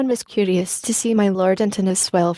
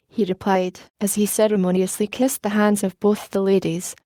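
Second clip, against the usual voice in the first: ordinary delivery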